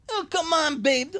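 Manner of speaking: high, loud, exclaiming character voice; wrong voice for boyfriend. Acted. Boyfriend line to Lori in the wrong voice, high. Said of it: "sorry, that's Bobby".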